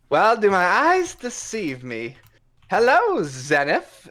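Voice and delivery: high, theatrical rogue greeting; swashbuckling cat rogue. Acted. GM voicing a rogue greeting Zenith, high and theatrical. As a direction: play the dashing cat thief, grandly.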